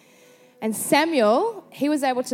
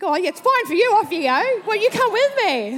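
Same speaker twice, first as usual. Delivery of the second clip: high, loud comic protest; mock indignant retort; 9 semitones higher, 6 dB louder than usual. Wife to husband and friend sending her off alone, indignant. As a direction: whine back in exaggerated outrage, pitch jumping up.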